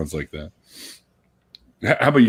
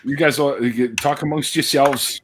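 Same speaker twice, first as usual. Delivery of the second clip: higher, lightly put-on talk show host; Coffee Talk host; 7 semitones higher than usual. Host telling guests to chat while away, lightly impersonated. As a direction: hand it over in a raised hostess voice. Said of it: "Rhonda something or other from Coffee Talk".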